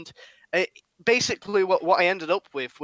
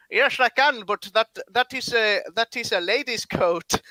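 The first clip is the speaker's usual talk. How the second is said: higher, mock vaguely Eastern accent for the shopkeeper; 5 semitones higher than usual. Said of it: "What's this accent you're doing for this guy?".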